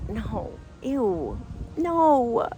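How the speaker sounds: retold disgust, climbing in pitch; turned off. Woman reacts to the kiss line, put-off disbelief. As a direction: start with a refusal, rise into baffled disgust. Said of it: "I was a little turned off".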